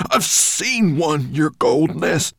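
high, loud, drawn-out dwarf voice; excited dwarf. A narrator's reading. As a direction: announce it urgently to your master. Dwarf excitedly reports to master.

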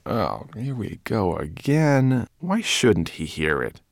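slow, exasperated groan. A narrator's reading. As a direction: drag it out, fed up. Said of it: "Ben groaned".

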